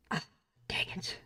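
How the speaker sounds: whispered frustration. Real, not acted. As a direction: hiss it under your breath. Mistake while drawing.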